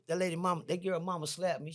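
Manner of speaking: ordinary delivery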